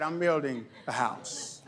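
quiet, slow, gentle promise; soft calm assurance. Retold or imitated. God quietly tells builders he builds the house. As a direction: softly settle the matter. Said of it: "God's like".

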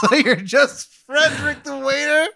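asking through laughter; laughing. Real, not acted. Listener laughing while asking.

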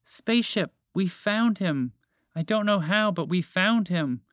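high creature voice with drawn-out words; small alien creature. A narrator's reading. Creature reports to its spaceship, high and drawn out. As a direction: pitch it up like a little creature.

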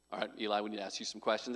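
low, quiet voice of a serious interviewer. Retold or imitated. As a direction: speak quick and low, like a solemn panel member. Serious interview panel voiced in story.

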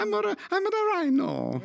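high cartoon Mario-ish voice. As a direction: squeak it like Mario. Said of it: "that was very much Mario".

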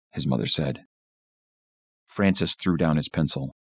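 ordinary delivery, nothing marked

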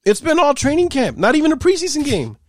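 high, loud disbelieving rant; you can't believe what you just heard; exasperated outburst. Real, not acted. Incredulous rant at the clip.

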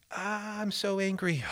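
high, slow, drawn-out complaint; whiny frustrated believer. Retold or imitated. A struggling believer admitting his anger, in a high whiny moan. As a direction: moan it like someone fed up with himself.

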